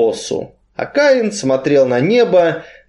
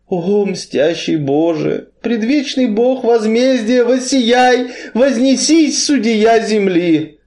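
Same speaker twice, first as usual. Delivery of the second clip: higher, slow, pleading prayer; praying a psalm; 9 semitones higher, slower than usual. A narrator's reading. Kain, huddled by the river, prays to God for vengeance. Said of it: "молился Каин".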